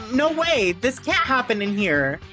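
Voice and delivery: high, shocked cry; alarmed protest. Acted. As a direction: protest in disbelief.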